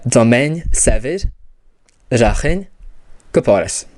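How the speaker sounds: slow, deliberate Irish sentence; Irish pronunciation. Shown as a demonstration. Teacher modelling the Irish sentence for learners, slowly. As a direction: model "Domain Savid, Rachin, Kaporis" slowly, as spoken in Irish. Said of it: "And in Irish, we'd have".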